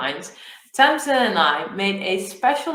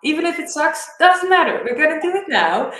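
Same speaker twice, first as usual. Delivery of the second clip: louder, higher, re-enacted pep talk; insistent urging; 4 semitones higher, 7 dB louder than usual. Coach urging her hesitant partner to record, insistent. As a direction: push a reluctant friend to act now.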